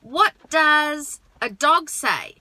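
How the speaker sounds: "What does a dog say?" slow, loud, "What" stretched; slow, clear question. Shown as a demonstration. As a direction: model it slowly and clearly. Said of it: "over time withdraw".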